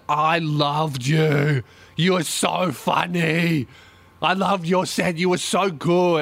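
slow, gushing drunk-admirer impression; tipsy female fan. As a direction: imitate a drunk fan, stretching out the words. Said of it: "she's a little bit drunk".

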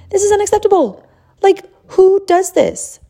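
high-pitched, incredulous mock fury; retold outrage. Mother fuming over her son's shoved clothes, retold outrage. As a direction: act out being flaming pissed, pitch shooting up. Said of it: "was flaming pissed".

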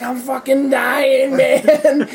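put-on high, slow, laughing drunken whine. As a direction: drag it out like a dying drunk.

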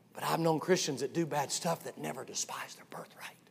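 soft whispered aside; hushed confiding whisper. Acted. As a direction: whisper it to the crowd.

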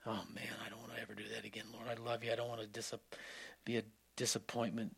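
soft, fast, hushed heartfelt prayer; quiet prayer. Retold or imitated. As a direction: murmur it quickly as a private vow.

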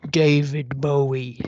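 deep crooner demo. Shown as a demonstration. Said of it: "It should be more like".

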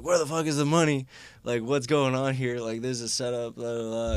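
high, loud mock biker yelling; angry biker demand. Biker demanding his money, loud and angry. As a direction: bark it like a furious biker wanting his cash.

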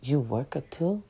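low, quiet and slow, astonished; drawn-out disbelieving question; amazed repairman. Retold or imitated. Repairman amazed she also has a job, low and slow.

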